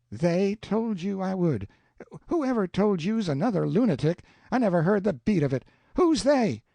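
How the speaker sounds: rising outrage to demanding; Aunt Sally demanding names, rising to a shout; building fury. A narrator's reading.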